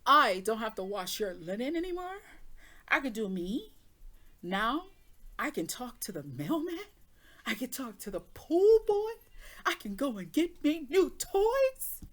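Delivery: building from lively to loud and high, slowing at the end; rising glee at being free of him; gleeful freedom. Retold or imitated. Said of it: "You know, you realize".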